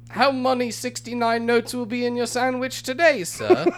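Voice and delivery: put-on a butler's drawling commoner accent. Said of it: "drawled in his commoner accent".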